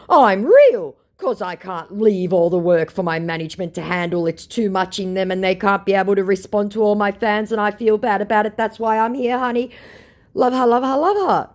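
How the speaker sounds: mock scammer. Reading out the scammer's gushing reply in a high mock voice. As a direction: read it as a gushing phony. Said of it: "scammer's reply read out".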